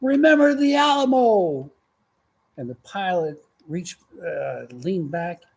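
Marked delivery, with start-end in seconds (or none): 0.0-1.7 high, loud mock battle cry; Texan's yell. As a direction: holler it like a proud Texan jumping. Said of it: "and he yelled".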